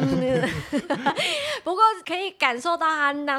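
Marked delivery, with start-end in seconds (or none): 0.5-1.6 light laugh. Real, not acted. Laughs after story.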